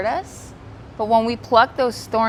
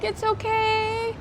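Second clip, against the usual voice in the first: slow, tender reassurance; gentle, slow comfort, the teacher's own soft reply; reassuring; 11 semitones higher, 5 dB quieter, slower than usual. Retold or imitated. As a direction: soothe a crying student. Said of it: "And I'm like".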